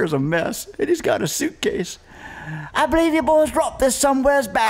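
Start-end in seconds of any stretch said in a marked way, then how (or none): 2.7-4.7 high, chirpy Cockney-ish local voice; a helpful Londoner returning lost luggage; British cyclist. Retold or imitated. Cyclist telling the band they dropped a suitcase, in a high London accent.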